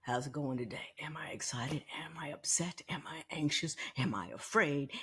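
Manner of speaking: hushed whisper asking herself; checking her morning mood in a soft whisper; whispered self-check. Shown as a demonstration. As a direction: breathe the questions to yourself, barely voiced. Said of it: "think about how I feel".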